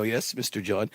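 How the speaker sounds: a hint of a subdued call-centre agent; lightly voiced operator. Skit, voicing OnStar operator.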